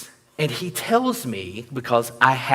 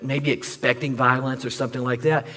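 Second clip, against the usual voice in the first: ordinary talk, nothing marked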